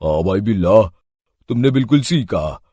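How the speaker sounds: second thief. Acted. Frightened second thief agrees with his partner Billa. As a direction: answer your partner in a deep, frightened voice.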